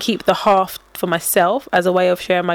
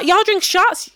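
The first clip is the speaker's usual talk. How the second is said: higher, imitated North American drawl; hammed-up North American question; mock North American accent; 10 semitones higher, much slower than usual. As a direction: mimic an eager North American, pitched up. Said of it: "They'll be like".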